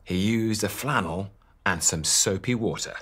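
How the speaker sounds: plain reading voice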